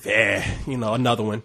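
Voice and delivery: low, soft indifferent shrug; mock unimpressed, barely caring; lightly voiced bored viewer. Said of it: "I was like".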